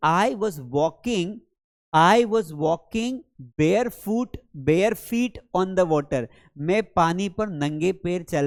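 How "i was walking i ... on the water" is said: very slow, each word spaced; slow model sentence. Shown as a demonstration. Teacher reading an example sentence slowly to students. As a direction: read it out for learners. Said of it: "teacher models example slowly".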